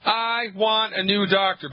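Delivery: high-pitched, heated mock tirade; indignant patient. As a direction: play a fed-up patient firing the doctor. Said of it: "I want her to say".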